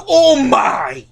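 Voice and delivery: loud, high exclamation falling away; mock overwhelmed cry. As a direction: cry out like a stunned rider. Imitating riders on the intense side.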